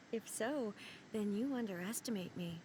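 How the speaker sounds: usual speaking voice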